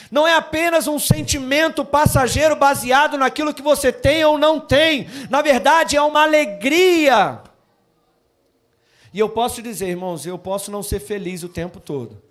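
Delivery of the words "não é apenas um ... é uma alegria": loud, high sermon proclamation; full-force preaching. Acted. Pastor proclaims joy to the congregation, loud preaching. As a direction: preach it out to the whole church.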